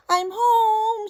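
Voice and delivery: high, loud happy call; glad homecoming call. Acted. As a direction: call it out to the whole house. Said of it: "she says".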